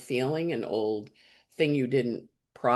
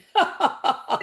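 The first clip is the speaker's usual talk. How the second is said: high laugh; 4 dB louder than usual. Real, not acted. Laughing at own story.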